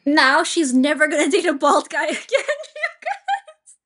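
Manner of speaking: high, loud wail breaking into laughter; laughing dismay. Real, not acted. Host reacting to the date story, laughing dismay. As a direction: exclaim loudly, cracking up at the end. Reacting in mock despair to video.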